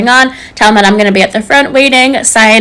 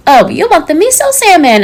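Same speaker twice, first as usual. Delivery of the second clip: mocking echo in a raised pitch; high-pitched mimicry; 6 semitones higher than usual. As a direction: parrot the order back in a higher voice. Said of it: "high-pitched voice".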